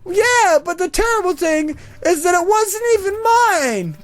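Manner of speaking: put-on very high old lady wail; hammed-up aggrieved old lady; elderly woman. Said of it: "it's this old elderly woman".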